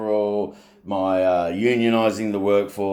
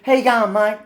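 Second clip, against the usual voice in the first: cheery greeting; 13 semitones higher, 6 dB louder than usual. Retold or imitated. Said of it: "every time".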